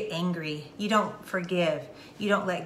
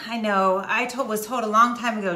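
usual speaking voice

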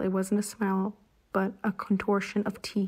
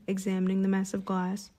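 usual speaking voice

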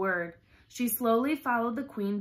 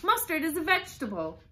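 quick, high-pitched exclamation; exclaiming; 8 semitones higher, 4 dB louder, faster than usual. A narrator's reading. Alice tells the Duchess what mustard is, exclaiming. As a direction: announce it fast and high, like a discovery. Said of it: "exclaimed Alice".

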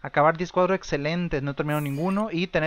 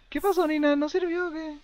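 much higher, playful taunting question; mock teasing; 11 semitones higher than usual. Real, not acted. Gamer teasing his sim Nina over the burnt food, high mocking tone. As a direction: needle her playfully.

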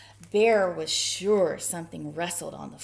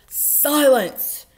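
loud, high roar; furious roar; 6 semitones higher, 14 dB louder than usual. A narrator's reading. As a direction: roar like an angry bear. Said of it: "Bear roared".

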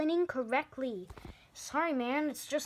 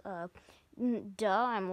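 normal talking voice

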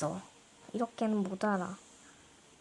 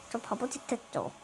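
ordinary talk, nothing marked

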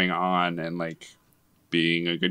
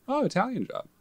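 high-pitched, softer voice for mom; imitated mom; 5 dB quieter, faster than usual. Retelling mom's reaction at the movie, high imitated mom voice. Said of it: "and she goes".

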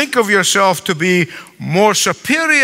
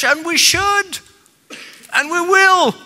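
rising emphatic preaching; 12 semitones higher than usual. Real, not acted. Preacher building to emphatic point.

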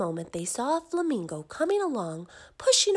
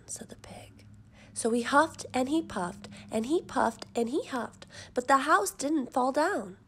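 ordinary delivery

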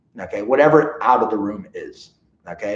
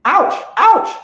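very high squealed repeated ouch; frantic ouch ouch; 6 dB louder than usual. Shown as a demonstration. Trainer showing owners the wrong panicky way to say ouch. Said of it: "instead of just saying".

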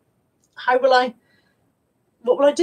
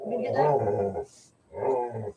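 much lower, drawn-out teasing question; low dog talk; 12 semitones lower, 6 dB quieter, much slower than usual. Real, not acted. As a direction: drop way down and stretch it for the dog. Deep slow dog talk.

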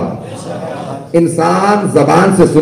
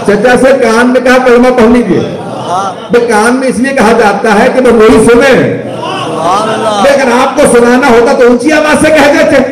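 raised pulpit voice, climbing higher; impassioned preaching; 5 semitones higher than usual. Real, not acted. Preacher marvels at Muslims' selective hearing, impassioned and rising. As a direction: build astonishment towards a high, forceful cry. Preacher builds indignant astonishment to climax.

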